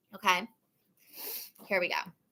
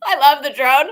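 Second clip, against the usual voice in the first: laughing; 6 semitones higher, 10 dB louder than usual. Real, not acted. Laughs at the drone story.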